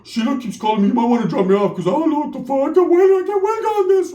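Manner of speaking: high, panicky primo voice; desperate to get out, voice rising high; freaked-out primo. Retold or imitated. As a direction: pitch climbing as the excuses pile up. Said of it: "My primo's so freaked out".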